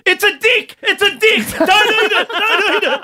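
put-on very high-pitched panicked kid yell; son yelling out. Son warning everyone at the dinner table, high mock yell. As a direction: squeal it like an alarmed child. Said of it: "My son yells out".

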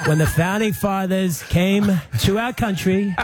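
a slow, grandiose Trump imitation, lightly laughing; Trump impression. Premier does Trump for radio hosts, slow and grandiose. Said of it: "your Trump impersonation".

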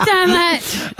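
lightly hammed-up busted boyfriend; mock frustrated [name]. Partner caught by the prank, mock exasperated.

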